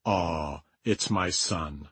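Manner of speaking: soft, low, slow parental sigh; hushed, drawn-out fondness, voice dropping; fond parent. Retold or imitated. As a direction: melt quietly like a doting parent. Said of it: "and they think".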